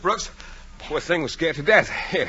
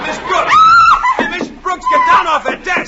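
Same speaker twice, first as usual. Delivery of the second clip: frantic yell; 13 dB louder than usual. Acted. Yelling at teacher on desk.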